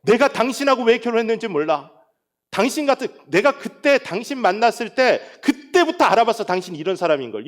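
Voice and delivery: high, loud acted marital outburst; heated accusation, re-enacted, easing at the end; angry spouse. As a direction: imitate a spouse lashing out. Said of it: "막 화가 나서".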